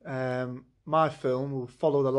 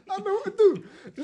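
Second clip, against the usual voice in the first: mock Italian accent; much faster than usual. Acted Earl's realisation.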